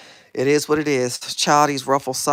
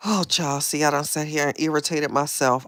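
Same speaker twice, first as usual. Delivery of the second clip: usual speaking voice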